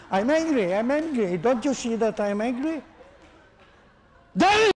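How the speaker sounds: loud, high furious shouting; angry demo. Shown as a demonstration. Teacher acts out anger for the class, loud high shout. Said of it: "Can you see my voice? Everything gets angry".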